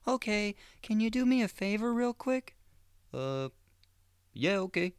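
very high, overly eager fake voice; super chipper. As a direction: keep the cheery sucker act going. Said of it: "super fucking chipper".

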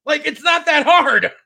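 real exasperation; exasperated rant. Real, not acted. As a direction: vent your disbelief at the screen. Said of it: "And it's like".

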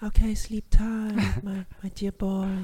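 high, drawn-out creepy lullaby voice; unheimlicher Arzt. Acted. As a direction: croon it high and slow like a sinister doctor. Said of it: "der Arzt so plötzlich". (hohe, gedehnte Arztstimme zum Einschlafen)

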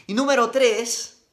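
a high, loud announcement of the ranking; a loud, high, showman countdown; excited announcing. Real, not acted. Counting down his favourite accents.

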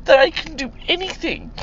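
real tearful outpouring, pitched high; high, emotional, close to crying; blubbering. Real, not acted. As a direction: push the words out through real tears. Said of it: "I'm just a blubbering".

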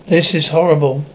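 plain reading voice

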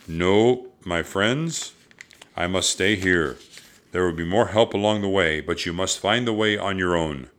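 loud, declamatory master's voice; firm, projecting wise mentor; solemn ninja master. A narrator's reading. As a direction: counsel young pupils with authority.

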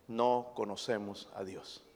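quiet, low statement. Acted. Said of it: "Hermanos, no conocemos al Dios".

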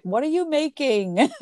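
high-pitched mock holler, not full volume; imitated yell downstairs. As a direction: call it down like a teen upstairs, playfully. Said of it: "like, yelled down".

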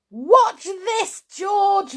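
high, loud excited shout; loud, high-pitched call. A narrator's reading. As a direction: shout for attention. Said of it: "Mister Cranky shouted".

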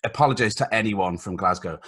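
usual speaking voice

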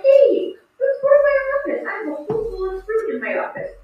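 put-on bright, high and quick, calling a kid over; cheerful high greeting. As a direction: sing it out to welcome a kid in. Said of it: "And I'll be like".

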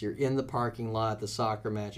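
usual speaking voice

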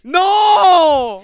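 loud anguished no; mock anguished cry. Real, not acted. Cohost crying out at the donation, loud.

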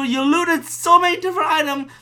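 usual speaking voice

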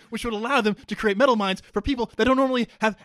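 fast, loud, unstoppable technobabble; talking over interruptions. Acted. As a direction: barrel through the explanation, raising the voice over the heckler. Barrels on over interruptions of his name.

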